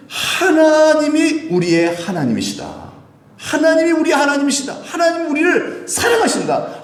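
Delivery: full-force preaching. Real, not acted. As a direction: cry it out to the congregation like a declaration. (높고 길게 끌며 우렁차게 선포하는 설교)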